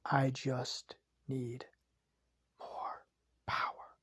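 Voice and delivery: slow, hushed, longing villain whisper; whispered craving. Acted. Villain craving power, fading to whisper.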